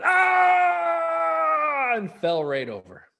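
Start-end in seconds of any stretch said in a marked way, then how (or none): 0.0-1.9 high loud startled yell; frightened yell. Acted. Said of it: "The man yelled".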